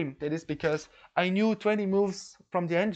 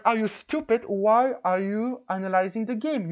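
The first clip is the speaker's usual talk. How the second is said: louder, indignant questioning; retold angry challenge; 4 semitones higher, 5 dB louder than usual. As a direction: re-enact an outraged confrontation. Retells confronting the cheater.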